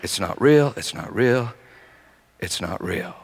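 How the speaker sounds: quiet, fading retelling of the desperate plea; softly re-enacted pleading. Father recalls pleading with his daughter, now quietly. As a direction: say it softly, remembering the moment.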